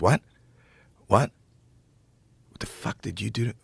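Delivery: quiet, halting imitation of a stunned comic; hushed disbelief. Comic leans down to someone in the front row, hushed disbelief.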